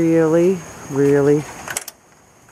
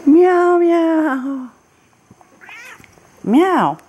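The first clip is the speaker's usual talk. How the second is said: imitated meows; 11 semitones higher, 4 dB louder, much slower than usual. Meowing at the cat.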